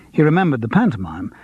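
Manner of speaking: plain reading voice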